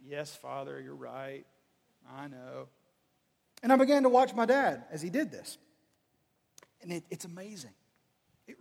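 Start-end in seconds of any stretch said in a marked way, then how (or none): 0.1-2.7 low, very soft surrender; chastened. Acted. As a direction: give in meekly, low and quiet. Chastened son gives in to father.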